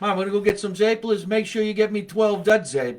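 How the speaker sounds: shouting to mom, then mom answering; mock family exchange. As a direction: play both sides of a family shout. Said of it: "my mother would say".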